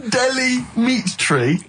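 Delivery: high, slow, laughing "Delhi meat tree?"; laughing disbelief. Real, not acted. Mocking the co-host's terrible clue while laughing. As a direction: drag it out through a laugh.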